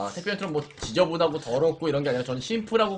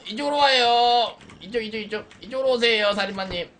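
yelling across the room; 7 semitones higher, 3 dB louder than usual. Acted. Luring the killer toward them.

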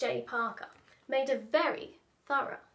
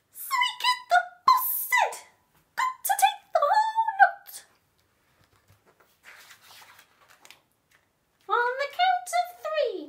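very high loud squeaky henchmen; squeaky mice; 6 dB louder than usual. Acted. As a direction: chant the count cheerily.

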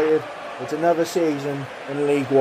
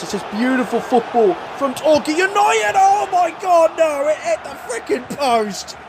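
rising live match call, then a higher cry; excited commentary; 10 semitones higher than usual. Acted. Gamer commentating his own match, excited. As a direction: call the near miss like a TV commentator.

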